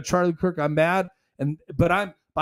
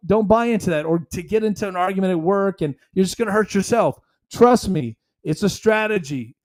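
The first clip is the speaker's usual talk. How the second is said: ordinary talk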